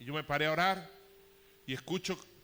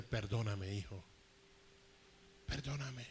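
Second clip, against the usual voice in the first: a hint of a tearful father, lower, softer; father begging forgiveness; 5 semitones lower, 7 dB quieter, slower than usual. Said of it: "empezó a decirme".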